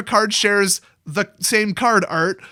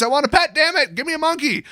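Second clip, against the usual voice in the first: excited whine; 8 semitones higher, 3 dB louder than usual. Real, not acted. Mock demand for a pet.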